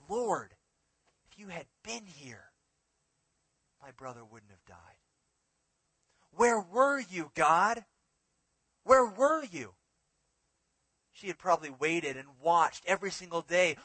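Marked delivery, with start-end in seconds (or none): 0.1-5.0 softer, sinking into quiet letdown; disappointed. Acted. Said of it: "the tone in her voice". 6.3-9.7 louder and higher, slow pleading where were you; anguished cry. Acted. Martha's anguished cry to God.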